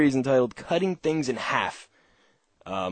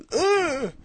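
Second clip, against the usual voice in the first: high, much higher disgusted ugh; disgusted groan; 4 dB louder than usual. Retold or imitated. Host mocking people who sneer at New Jersey.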